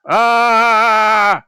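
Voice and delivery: loud, high-pitched sustained screech; kobold screech. Acted. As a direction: screech like a startled little monster. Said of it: "They screech loudly".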